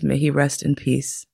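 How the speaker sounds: ordinary talk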